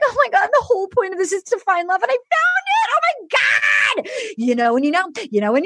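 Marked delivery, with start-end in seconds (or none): 0.0-4.1 breathless rush climbing very high; ecstatic mock squeal. Woman who found love on the show, ecstatic squeal.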